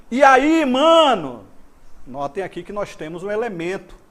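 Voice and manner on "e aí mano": loud, high hail to a mate; calling someone. Shown as a demonstration. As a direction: call out to your buddy as a demonstration. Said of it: "um chamamento". (chamando o amigo em voz alta)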